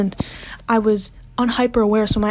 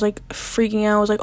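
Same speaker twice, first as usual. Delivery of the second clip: ordinary talk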